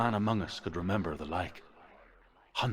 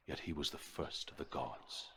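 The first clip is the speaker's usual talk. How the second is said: hushed ending; 6 semitones lower, 10 dB quieter than usual. A narrator's reading.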